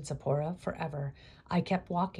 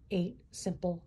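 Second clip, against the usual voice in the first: ordinary delivery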